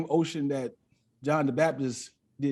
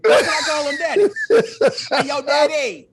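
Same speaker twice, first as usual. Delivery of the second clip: higher and louder, laughing while calling out; hollering a cheeky greeting up to heaven; laughing, playful; 10 semitones higher, 9 dB louder than usual. Pretend. Joking host calls out to God, laughing and loud.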